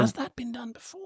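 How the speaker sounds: breathy stage whisper. Real, not acted. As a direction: whisper the question conspiratorially. Aside during crossover chat.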